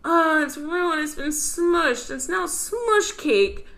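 put-on high, slow sad kid voice; Daniel Tiger voice. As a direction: whine it out like a sad cartoon kid. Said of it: "And he says".

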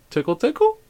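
high, tentative tickling voice; playful coaxing. Retold or imitated. Dad tests daughter playing dead, high playful coaxing. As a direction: coax in a small high singsong.

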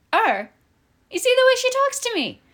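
indignant outburst. Acted. Celia appeals to Eddie, high and indignant. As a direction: shriek it in offended disbelief.

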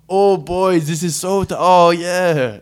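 high, loud put-on voice of Cat, hammed up; bad Cat impression. Podcast host doing a bad impression of Cat for his co-host. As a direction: ham up a loose impression of Cat calling the boys. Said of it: "That was a bad Cat impression".

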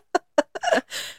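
unvoiced giggle; breathy laugh. Real, not acted.

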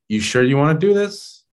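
high, rising doubtful query; doubtful question. Retold or imitated. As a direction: question the boss sceptically, pitch climbing. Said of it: "you're just like".

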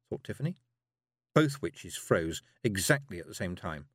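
usual speaking voice

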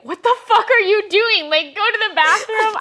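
loud high outraged yell; shocked furious shout; angry yell. Retold or imitated. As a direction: bark it at intruders in your home. Angry retort to intruders in home.

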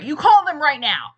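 loud, commanding order; bossy demand. Pretend. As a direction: order him to call now. Acted bossy girlfriend demand.

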